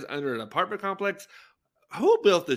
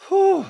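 loud high-pitched whew of lust; fanning herself over a hot actor, loud whew; 11 dB louder than usual. Real, not acted. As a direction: blow out a big hot whew. Thirst reaction exclamation.

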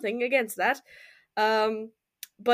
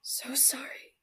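soft, hushed, grieving apology; Ella whispering sorry at her friend's corpse; tearful whisper; 5 dB quieter than usual. Acted. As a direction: kneel and apologise through quiet tears. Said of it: "silently crying".

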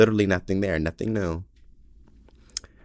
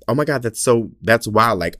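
ordinary talk, nothing marked

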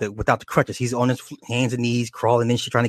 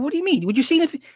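much higher voice, a jittery cop asking back; nervous partner cop; much faster than usual. Retold or imitated. Partner cop questions the driver, high and jittery. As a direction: squeak it out like a scared rookie.

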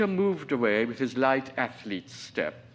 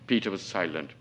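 ordinary delivery, nothing marked

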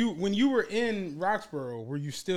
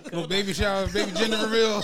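laughing; 4 semitones higher, 6 dB louder than usual. Real, not acted. Joking about lots turned party spots.

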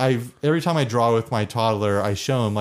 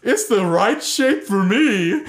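higher, louder, crying moon character; 8 semitones higher, 4 dB louder, slower than usual. Pretend. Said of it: "the character with the moon voice".

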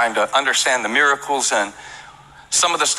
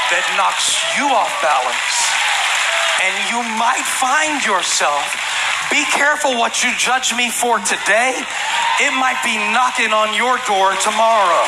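higher, urgent warning; pointed warning, higher; 7 semitones higher than usual. Real, not acted. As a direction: caution them not to judge. Warning in rising voice.

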